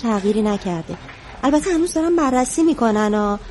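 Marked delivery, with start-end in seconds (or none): 1.4-3.4 hesitant. Acted. Nurse to daughter before bad news, hesitant. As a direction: stretch it out, reluctant to finish.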